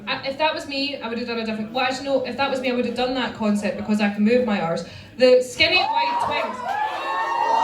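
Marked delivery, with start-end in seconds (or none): 5.2-7.6 shouted high and drawn out; high mock shout. Real, not acted. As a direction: yell it out high and slow. Outburst mid critique.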